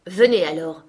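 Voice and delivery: loud, firm order; forceful summons. A narrator's reading. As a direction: say it firmly, allowing no argument. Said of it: "lui dit-il avec fermeté".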